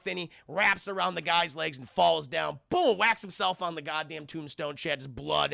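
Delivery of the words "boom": high, loud "boom" of a crash; sudden vocal sound effect; imitated impact boom. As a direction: punch out a crash noise. Imitates the man hitting tombstone.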